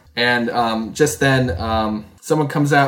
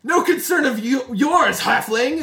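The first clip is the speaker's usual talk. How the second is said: villain voice; 11 semitones higher than usual. Acted. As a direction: look down on the halfling from on high. GM voices Bixby answering Nora.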